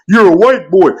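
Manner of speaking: mock accuser. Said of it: "he tries to come with these".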